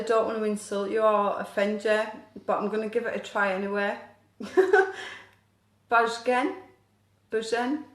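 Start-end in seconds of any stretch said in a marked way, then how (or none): 4.4-5.2 laugh. Real, not acted. Embarrassed laugh at name.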